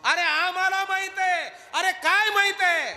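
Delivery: 1 excited shout. Acted. Gopals shouting at guards.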